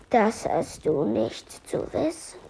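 low, soft, slow monster voice; a brushing-off monster reply. Acted. Monster rebuffs Emilia, low and slow.

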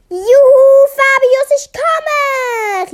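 high, loud, drawn-out call; joyful calling out. A narrator's reading. Melia calls to waiting Fabius, joyful shout. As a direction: holler happily to a friend. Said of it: "rief sie".

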